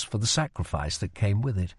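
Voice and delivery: usual speaking voice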